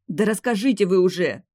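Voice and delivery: impatient demand. A narrator's reading. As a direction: press them to spill it already. Demia demands an answer.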